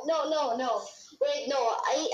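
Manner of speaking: normal talking voice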